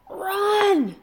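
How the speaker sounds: high, loud shout of warning. A narrator's reading. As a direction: yell it to make them flee. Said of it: "shouted Hiccup".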